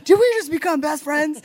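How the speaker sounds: Step Brothers quote. Retold or imitated. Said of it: "that Step Brothers moment".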